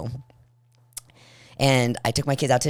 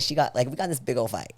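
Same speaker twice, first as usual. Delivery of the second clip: normal talking voice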